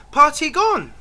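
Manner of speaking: high mock child voice; imitating the little French boy. Imitating a French boy waking him up. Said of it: "he's going".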